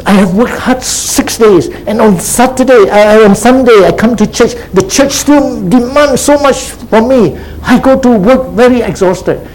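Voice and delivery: high-pitched, put-upon complaint from a weary worker; complaining churchgoer. Acted. Church member grumbling to the preacher about Sunday's demands, high-pitched complaining. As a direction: voice an overworked member's protest, pitched well up. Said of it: "Some people say".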